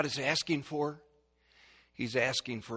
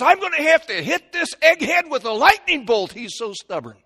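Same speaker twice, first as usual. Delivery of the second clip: loud, high, fed-up threat; exasperated shout; 13 semitones higher, 13 dB louder than usual. Acted. The Lord exasperated with stubborn man.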